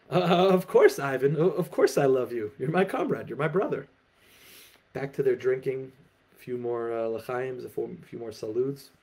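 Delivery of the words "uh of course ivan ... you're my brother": higher, louder, caught off guard; flustered reassurance. Acted. Startled Igor reassures Ivan over vodka, flustered and louder. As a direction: hurry to reassure your comrade. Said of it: "Igor is taken aback".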